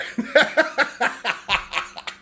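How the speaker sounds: high, chuckling laugh at own punchline; helpless high-pitched cackling; real belly laugh. Real, not acted. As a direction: burst into a run of high giggles.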